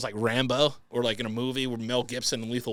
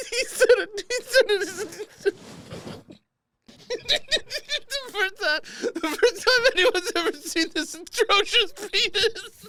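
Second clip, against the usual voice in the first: very high, helpless squeal of laughter; cracking up; slower than usual. Real, not acted. Host losing it over the email's wording, cracking up. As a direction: squeeze the words out through real laughter, much higher. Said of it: "I'm laughing at the word atrocious".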